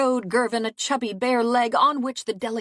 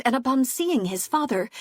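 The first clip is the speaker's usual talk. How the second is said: plain reading voice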